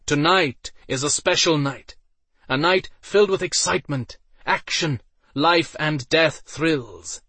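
loud, high hype voice; showman announcer. A narrator's reading. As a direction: sell it to the crowd. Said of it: "As Iden spoke".

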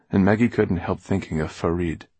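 usual speaking voice

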